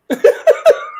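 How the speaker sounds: a burst of high, breathy laughter; real amused laugh. Real, not acted. Laughing at a friend's teasing.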